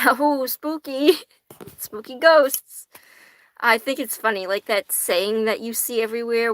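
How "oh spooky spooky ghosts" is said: playful, drawn-out ghostly voice; hammed-up spooky whoo with a breathy finish; mock spooky. Teasing viewers about witch ancestry, mock spooky.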